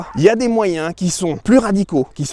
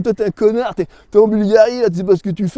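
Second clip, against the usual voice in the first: mock critic; 4 semitones higher than usual. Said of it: "ils vont dire".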